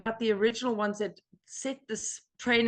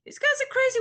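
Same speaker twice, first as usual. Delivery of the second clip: high falsetto, louder mock disbelief; mock bewilderment; 5 dB louder, much faster than usual. Newcomer recalling first thoughts of the group, high mock bewilderment. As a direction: leap way up in pitch, comically baffled. Acting out early baffled thoughts.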